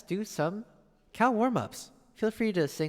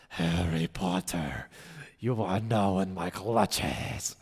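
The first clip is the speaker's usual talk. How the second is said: much lower, slow menacing villain voice; Voldemort impression; 8 semitones lower, much slower than usual. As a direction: drop low and slow, savoring the threat. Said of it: "what does Voldemort say generally".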